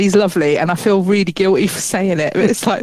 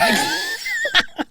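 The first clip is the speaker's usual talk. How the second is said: high squealing laugh; 5 dB quieter than usual. Real, not acted. Laughing at her dare about the chalkboard, squealing. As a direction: shriek of laughter, high and breathless.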